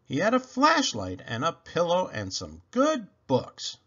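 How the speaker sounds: ordinary delivery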